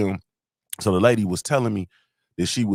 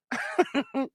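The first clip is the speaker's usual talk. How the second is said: short high-pitched, soft laugh; 5 dB quieter than usual. Real, not acted. Fan laughing at his own starstruck story, amused.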